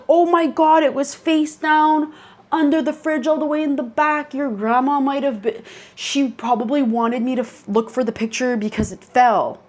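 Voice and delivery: amazed discovery. Retold or imitated. Mom finding photo under fridge, amazed.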